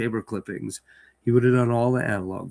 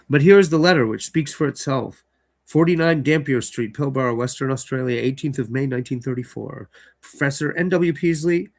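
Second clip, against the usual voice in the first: louder dramatic reading of the letter; story narration; 3 semitones higher, 5 dB louder than usual. Acted. Host reading the letter in the story aloud, dramatic narration. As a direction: open the letter with weight.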